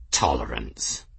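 low, scornful echo of the word; grumpy, dropped-pitch disdain; scowling scorn. A narrator's reading. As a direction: throw it back with a low scowl. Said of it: "said with a scowl".

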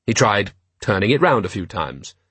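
plain reading voice

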